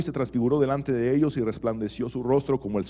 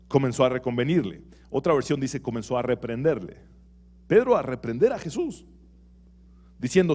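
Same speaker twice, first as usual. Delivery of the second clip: ordinary talk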